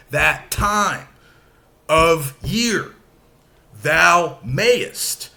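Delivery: phrase-by-phrase, second beat hit; iambic stress. Shown as a demonstration. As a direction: scan in iambs. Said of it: "Unstressed followed by stressed".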